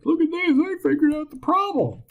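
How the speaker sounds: high, loud self-mocking squeal; mock fired-up. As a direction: parody your own giddy triumph, pitch way up. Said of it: "Got all fired up".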